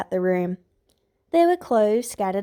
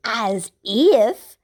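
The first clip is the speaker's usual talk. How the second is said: spat out; 6 dB louder than usual. A narrator's reading. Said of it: "Adara spat".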